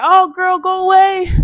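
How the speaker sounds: high, loud go away; shooing off. Retold or imitated. Cleo telling Frankie to leave, high and loud. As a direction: brush her off sharply and loudly.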